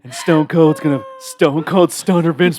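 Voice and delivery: loud, laughing trash-talk promo; wrestling promo. Pretend. Wrestling promo as Stone Cold.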